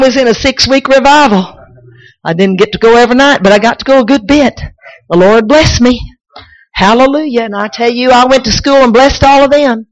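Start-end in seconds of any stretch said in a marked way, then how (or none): none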